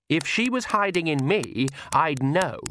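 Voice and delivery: touchy daughter snarling lightly. A narrator's reading. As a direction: snap back with a mild snarl. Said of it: "snarled Lacrimosa".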